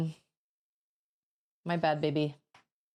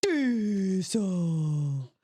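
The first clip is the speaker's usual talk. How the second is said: put-on toddler voice. Mother imitating her toddler's answer to her sister, drawn out. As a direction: say it like a little kid answering. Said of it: "Auden would go".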